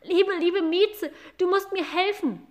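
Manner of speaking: put-on a small boy begging for help; boy pleading. Boy begs the cat for help, high pleading voice. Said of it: "sagte der Junge".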